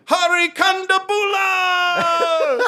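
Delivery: high, drawn-out announcer cry on Kondabula; John Oliver introduction. Retold or imitated. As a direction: stretch Kondabula out, ending on a long "laah". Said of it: "introduce him".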